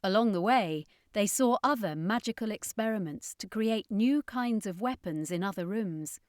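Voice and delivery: plain reading voice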